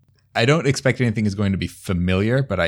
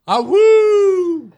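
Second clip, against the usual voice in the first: howling like a wolf; 9 dB louder than usual. Acted. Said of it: "I look back and be like".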